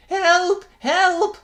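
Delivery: very high, loud panicked cry; frightened priest crying for help as the kettle jumps; terrified cry for help. Acted. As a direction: shriek for help in terror. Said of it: "cried the priest, terrified out of his wits".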